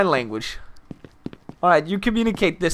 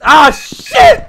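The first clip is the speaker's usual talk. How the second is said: high, loud outburst; startled swearing; 8 semitones higher, 8 dB louder than usual. Real, not acted. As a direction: yelp a curse in alarm. Sudden mishap during the call.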